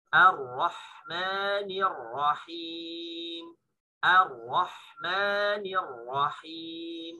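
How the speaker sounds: very slow Ar-Rahmanir Rahim with an open A; correct thin-A recitation. Shown as a demonstration. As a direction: recite it right, drawn out. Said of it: "Dilatih A ketemu lo".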